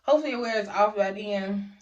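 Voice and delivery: careful slurred numb speech; numb mouth. Real, not acted. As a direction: push words past a numb lip. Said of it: "I can't even talk".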